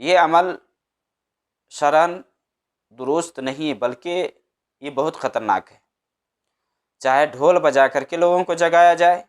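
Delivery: usual speaking voice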